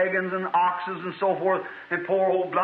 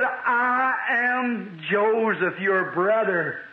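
solemn revelation; 2 semitones higher, much slower than usual. Acted. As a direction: reveal who you are, stretching each word. Preacher acts out Joseph's revelation.

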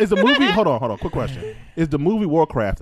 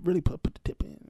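soft, fast, fading to a whisper; mocking the movie, a sly whispered tease; teasing whisper; 7 dB quieter, faster than usual. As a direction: tease it under your breath.

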